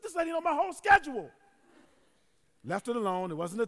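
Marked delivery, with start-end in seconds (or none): 0.0-1.3 high, loud incredulous reaction; acted astonishment. Pastor stunned by the nosy neighbor, acted astonishment. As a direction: exclaim it in mock alarm.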